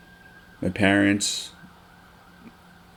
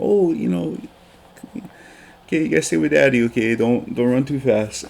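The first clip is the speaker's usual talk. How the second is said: soothing dad; 5 semitones higher than usual. Retold or imitated. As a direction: reassure a small child sweetly. Father calming son on walk.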